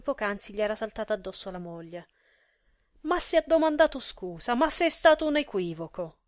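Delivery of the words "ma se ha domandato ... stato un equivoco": high, loud, exasperated outburst; furious protest. A narrator's reading. Gigi leaping at his friend to protest, furious. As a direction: jump on him with raised voice. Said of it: "gli saltò addosso quasi con la stessa furia".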